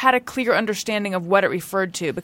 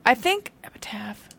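whisper; 5 dB quieter than usual. Real, not acted.

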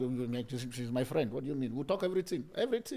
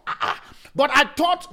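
a mock astonished boss, higher and louder; shocked boss; 8 semitones higher, 6 dB louder than usual. Boss shocked at your accounting, mock astonishment. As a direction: play the stunned boss. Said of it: "your boss is shocked".

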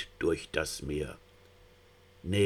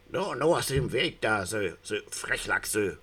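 bellowing eel; 3 semitones higher than usual. Acted. A drunken eel yelling at the young salmon to move. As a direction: shove them aside with a bark. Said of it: "brüllte ein Aal plötzlich".

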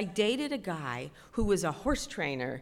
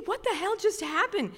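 high-pitched bewildered question; shocked disbelief; 12 semitones higher than usual. Retold or imitated. Rider staring at her collapsed horse, acted shock. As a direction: blurt it high in stunned confusion.

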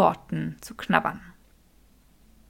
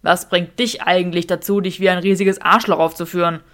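irritated Draco; 2 semitones lower, 7 dB louder than usual. Acted. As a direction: confront him, fed up. Draco confronts Sabini.

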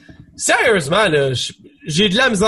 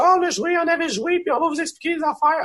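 high, smug mock influencer voice; 10 semitones higher, 3 dB quieter than usual. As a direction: parody the know-it-all influencers, pitched up. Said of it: "ont commencé à dire".

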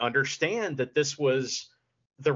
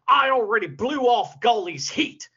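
higher, louder hammed-up wrestling-boss voice; mock Jack; 6 semitones higher, 5 dB louder than usual. Host imitating Jack's strange wrestling lingo, hammed-up boss voice. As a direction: ham up the boss's odd jargon. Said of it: "and Jack goes".

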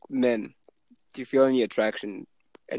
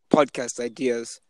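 ordinary delivery

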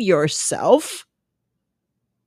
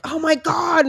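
high-pitched exasperated outburst; retold fed-up cry at burnt toast; pissed off; 8 semitones higher than usual. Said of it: "and I'm pissed off".

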